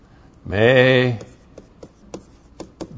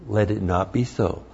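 weak reading; 4 semitones lower, 10 dB quieter than usual. Shown as a demonstration. Showing the literal translation sounds weak, soft. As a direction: Let it not be so dropped soft and low. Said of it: "that comes off a little bit weak".

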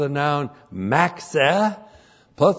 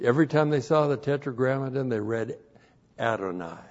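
ordinary talk, nothing marked

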